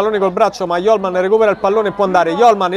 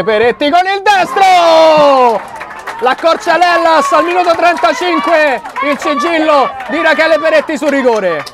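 excited; 7 semitones higher than usual. Real, not acted. Penalty scored.

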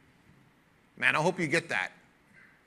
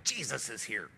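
mock terrified demon; 4 semitones lower than usual. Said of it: "the demons were like".

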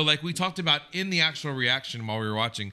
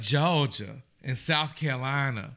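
slow Georgia drawl; Georgia Southern accent; slower than usual. Shown as a demonstration. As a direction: draw it out in a South Carolina accent. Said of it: "They all speak like this".

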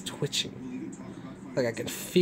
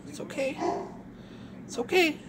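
high soothing coo; 14 semitones higher, 4 dB quieter than usual. Real, not acted. Soothing trembling dog.

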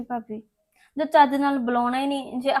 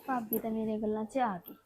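private scheming aside; 8 dB quieter than usual. Acted. Harpreet alone, plotting how to win over brother, quiet aside. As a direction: keep it hushed, as thoughts spoken alone.

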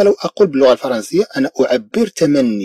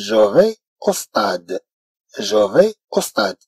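very slow, softer French; very slow model phrase; 4 semitones higher, 3 dB quieter, much slower than usual. Shown as a demonstration. French teacher giving the correct form, very slowly. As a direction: draw it out for learners. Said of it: "إذا سأقول".

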